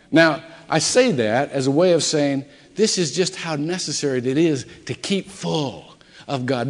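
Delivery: normal talking voice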